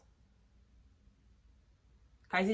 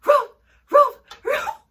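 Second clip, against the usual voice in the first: high, loud yapping bark; dog bark imitation; 13 dB louder, slower than usual. The man's dog barking at the visitor, yapping bark. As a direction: yap like a small angry dog. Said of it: "Or the dog go".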